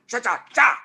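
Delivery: high, loud rhythmic "cha" bursts. Retold or imitated. Golfer shows how the hips moved, loud rhythmic sound effects. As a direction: punch out a sound for each hip move. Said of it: "he moved them back and forth".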